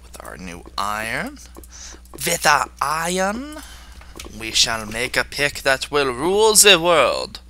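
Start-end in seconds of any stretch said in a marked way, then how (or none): 2.1-3.6 higher-pitched, slow mock-German delivery; playful German accent. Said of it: "I sounded a little German there". 4.4-7.4 grandly declaimed mock-German accent; playful German accent. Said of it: "I sounded a little German there".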